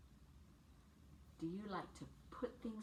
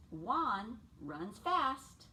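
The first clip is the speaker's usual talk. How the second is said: slow read; 4 dB louder, much slower than usual. Acted. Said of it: "And he says".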